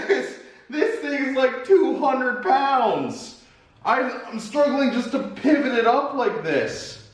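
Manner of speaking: high strained laughing words; carrying heavy load. Real, not acted. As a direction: laugh while heaving something heavy. Lifting heavy tabletop, laughing.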